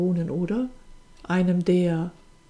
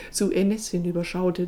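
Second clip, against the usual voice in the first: usual speaking voice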